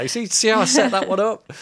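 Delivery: high, loud and delighted; crowing over his own setup; gleeful. Real, not acted. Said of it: "pleased with his setup".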